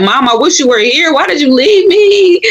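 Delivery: high, breaking plea to a lost mother; crying plea. Retold or imitated. Missing her mother while driving, a high crying plea. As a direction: cry out to her mom, voice cracking. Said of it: "I'm like".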